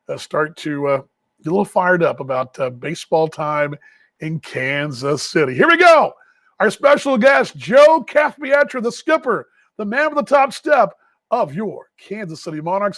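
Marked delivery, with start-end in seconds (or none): 5.5-13.0 hype announcer intro. Real, not acted. Host introduces the team manager to listeners, in a hype announcer voice. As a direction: introduce the skipper like the starting lineup. Hyping the interview guest.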